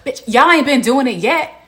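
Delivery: loud, high, fed-up snap; exasperated outburst. Real, not acted. Vlogger ranting at the wife on the show, loud exasperation. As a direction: blast it out in disbelief.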